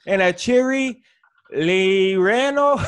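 mock Mexican accent, slow stretched ending; hammed-up Mexican accent. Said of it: "when I try to do a Mexican voice".

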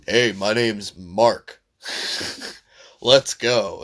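slow, hammed-up generic American guy; mock bland American. A hammed-up bland American Mario voice. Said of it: "And it's like".

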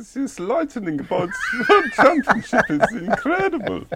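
high, loud, gushing mum voice; hammed-up doting mother in a piercing falsetto, laughter around it; mother impression. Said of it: "Sounds like my mother saying it".

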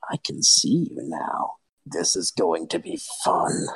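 deep, slow, whispery monster voice; menacing creature. Acted. Monster whose eyes have cleared threatens the party, menacing. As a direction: drop very low and draw out the threat.

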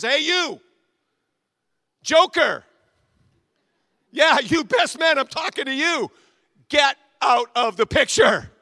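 high, loud, slow bark at the intruder; yelling photographer. Acted. Photographer yelling at the best man to leave the picture. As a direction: shoo him out of the shot.

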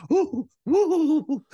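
high, loud hammed-up gasping coos; mock shocked mother. Imitating the mother watching the kiss from upstairs, hammed-up squeal. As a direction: squeal like a scandalised mom. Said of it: "the mother is standing at the top and she's like".

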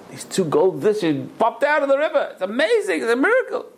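high-pitched, rising wonder at a miracle; amazed excitement. Acted. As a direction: build to an astonished, high-voiced proclamation. Servant reports the miracle to rabbi.

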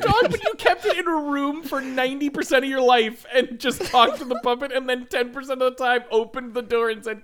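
high-pitched, laughing through the words; real giggling delivery. Real, not acted. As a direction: barely hold it together while building the joke. Riffing on joke while laughing.

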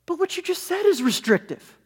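high mock inner-thought voice; Gaffigan inner voice. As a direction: pipe it up as the audience's private reply. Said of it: "goes into a high-pitched voice to answer it".

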